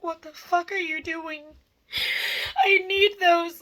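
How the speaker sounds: high, loud mock outburst; retold angry yell. To the boyfriend on the phone, retold furious yell. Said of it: "And I got so mad".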